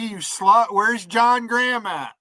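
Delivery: ordinary talk, nothing marked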